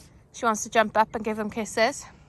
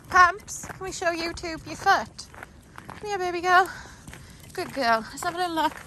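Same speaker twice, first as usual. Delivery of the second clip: much higher, sing-song coaxing; 9 semitones higher than usual. Real, not acted. Coaxing dog to show foot.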